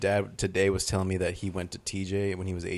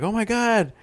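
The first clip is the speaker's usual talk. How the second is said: mock amazed gasp; 11 semitones higher, 4 dB louder, slower than usual. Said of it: "they're like".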